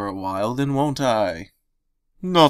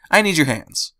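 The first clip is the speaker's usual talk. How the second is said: cheerful; 6 semitones higher, 3 dB louder than usual. Acted. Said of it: "she said cheerfully".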